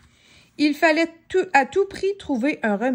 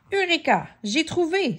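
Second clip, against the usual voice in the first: triumphant, higher eureka; excited cry; 6 semitones higher than usual. Acted. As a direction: shout it like a sudden idea. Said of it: "s'écria Monsieur Malin".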